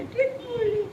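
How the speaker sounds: high storybook bird call; bird's taunt. Acted.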